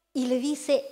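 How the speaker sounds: ordinary talk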